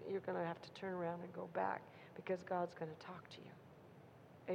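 hushed warning to the congregation; dramatic whisper. Acted.

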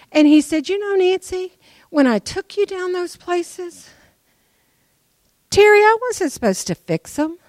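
high, loud, excited preaching; excited retelling. Retold or imitated. Preacher retelling God's words, high excited voice. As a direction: soar up as she tells what God said.

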